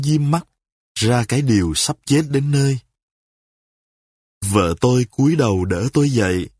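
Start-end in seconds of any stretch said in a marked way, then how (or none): none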